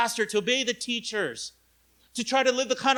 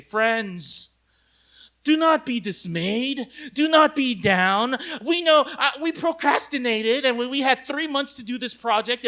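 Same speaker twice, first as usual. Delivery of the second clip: hammed-up pep talk, louder and building; rally the team like a movie hero; mock inspirational address; rousing leader speech; 4 semitones higher, 5 dB louder than usual.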